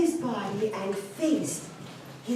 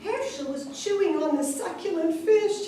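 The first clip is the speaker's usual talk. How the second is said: usual speaking voice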